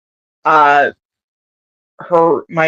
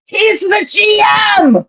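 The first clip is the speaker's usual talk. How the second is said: high, slow shouted call; yelling from another room. Retold or imitated. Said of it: "she yells from the other room".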